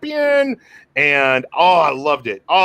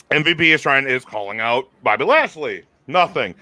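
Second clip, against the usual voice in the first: normal talking voice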